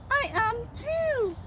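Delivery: high little cow; high, soft puppet voice; toy cow voice. Pretend. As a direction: answer as a tiny puppet cow.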